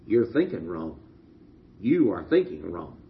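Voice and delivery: low, soft, grave voice of God. Retold or imitated. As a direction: speak down to them, low and solemn. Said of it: "God looked down on them and said".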